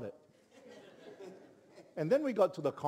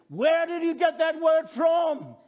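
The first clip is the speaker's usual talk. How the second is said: high, slow, drawn-out shout; booming demand; 12 semitones higher, 9 dB louder than usual. Retold or imitated. Big man who picked the preacher up, shouting.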